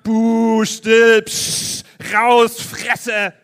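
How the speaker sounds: laute, höhnische Zwischenrufe; brüll den Bettler nieder; buhende Menge. Shown as a demonstration. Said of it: "ihr seid jetzt die Menge und ihr macht". (lautes Buhen und Zischen einer Menge)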